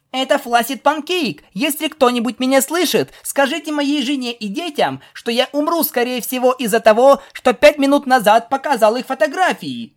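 high, urgent, melodramatic last message; pegasus playing doomed soldier. Pegasus to anyone listening on the radio, mock-desperate. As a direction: send a mock dying message over the radio.

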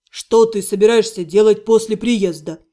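low voice for the best friend; male friend. A narrator's reading. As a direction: voice a male buddy asking, low.

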